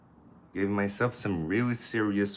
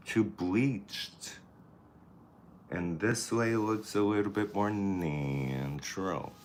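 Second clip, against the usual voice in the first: usual speaking voice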